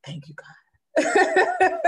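very slow, high, laughing thanks; grateful laughing thanks to God; laughing relief. Retold or imitated. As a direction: drag it out through a laugh of relief. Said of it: "you just be like".

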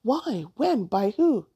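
high, incredulous burst of questions; startled, swooping questions; shocked disbelief. A narrator's reading. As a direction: fire off each question in disbelief. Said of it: "Shocked with disbelief".

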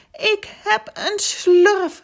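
high, loud, drawn-out joyful cry; happy shout. A narrator's reading. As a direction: shout it out slowly with glee. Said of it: "riep hij blij".

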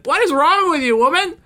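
put-on high, outraged kid voice; mock outraged child. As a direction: squeal it like an outraged first grader.

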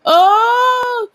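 sudden high "Oh!"; startled exclamation; surprised. Real, not acted.

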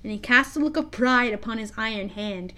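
ordinary delivery

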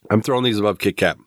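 ordinary talk

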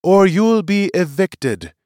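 high, loud, slow threat; stern warning. Acted. Seer threatens to remove the shouter, stern. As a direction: warn with full authority.

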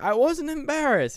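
indignant husband. Retold or imitated. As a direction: squeak it out defensively. Husband denies being embarrassed.

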